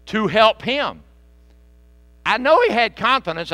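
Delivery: loud, high preacher's cry; full-voiced revival preaching; preaching at full force. Real, not acted. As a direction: lift the congregation with fervour.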